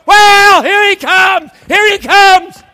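high, very loud joyful holler; excited shouting. Acted. Parent spotting the child coming home, excited shouting. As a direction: shout it out for everyone to hear.

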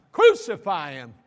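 a preacher voicing the mob calling for crucifixion, shouting; crowd crying out. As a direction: bellow it like an angry mob. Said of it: "think about them as they're crying".

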